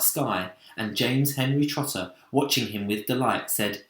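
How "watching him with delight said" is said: ordinary delivery, nothing marked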